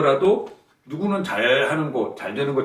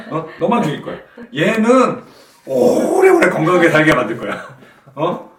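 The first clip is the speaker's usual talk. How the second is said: louder, mocking sarcasm, a sharp needle of irony; sarcastic jab; 4 semitones higher, 8 dB louder than usual. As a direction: ram it home with biting scorn, getting louder in the middle. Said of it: "이런 분위기예요". (비꼬듯 비아냥대며, 중간에 목소리를 높여)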